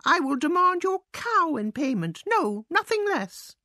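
high, indignant mouse demand; storming. A narrator's reading. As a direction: lay down terms in a high mouse voice. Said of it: "stormed the mouse".

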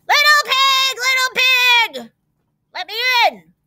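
high, loud wolf call; growling wolf. A narrator's reading. Hungry wolf at the pig's door, high loud call. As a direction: demand entry as the big bad wolf. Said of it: "growled the wolf".

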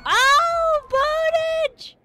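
very high, loud cry; excited shout. Real, not acted. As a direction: shriek it out in excitement. Player cries out on winning badge.

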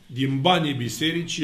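usual speaking voice

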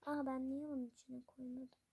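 ordinary delivery

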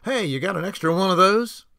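loud, high call to the baker; yelling. A narrator's reading. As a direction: shout it across to the baker. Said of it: "Damon yelled to the baker".

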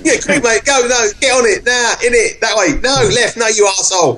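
high, urgent rough street directions; council estate voice. Acted. Joining in as estate sat nav, high bossy slang voice. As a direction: hurry the driver like a council-estate lad. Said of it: "And council estate".

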